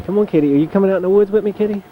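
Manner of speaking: high, coaxing call to a kitty; sing-song pet call, fast; coaxing the cat to follow into the woods, high call. Real, not acted. Calling the cat to come outside.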